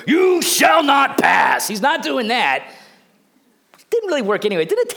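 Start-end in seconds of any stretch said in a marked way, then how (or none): 0.1-1.7 booming, drawn-out wizard's shout; Gandalf. Acted. Wizard defying a demon monster, full shout. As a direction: bellow it high and slow, every word hammered. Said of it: "like he's Gandalf telling that demon monster".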